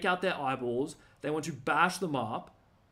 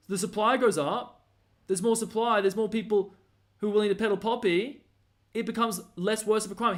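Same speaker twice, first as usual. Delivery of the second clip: ordinary talk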